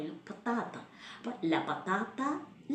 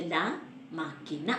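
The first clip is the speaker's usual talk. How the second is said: slow, spelled-out classroom model; teacher modelling ലാ മാക്കിന slowly; slow teaching demo; 2 semitones lower, much slower than usual. Shown as a demonstration. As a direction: say ലാ മാക്കിന slowly, stressing ലാ, for learners to copy. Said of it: "അതിൻ്റെ action കാണിക്കുന്നത്".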